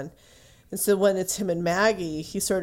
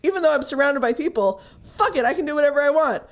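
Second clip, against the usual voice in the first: high, fast and louder, giddy; gleeful realization; 8 semitones higher, 5 dB louder, faster than usual. Retold or imitated. Maggie realizing her freedom, giddy and fast. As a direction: Maggie suddenly freed and thrilled. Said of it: "She's like".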